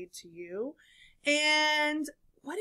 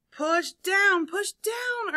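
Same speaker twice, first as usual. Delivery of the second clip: high, insistent repeated command; urging; 6 semitones higher, 4 dB louder, much slower than usual. A narrator's reading. Elephant urges the animals to push, urging. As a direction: drive them on, pitching the repeat higher. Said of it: "urged Elephant".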